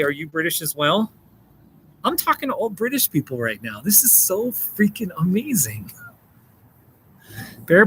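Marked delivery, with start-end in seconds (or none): 2.0-5.9 high, thrilled and a little softer; real excitement. Real, not acted. Streamer thrilled that all the viewers are British, excited. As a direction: gush with amazed delight, pitch up.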